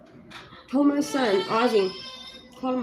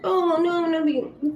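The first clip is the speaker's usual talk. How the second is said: mocking parent; 8 semitones higher, much slower than usual. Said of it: "you think that ... you can just".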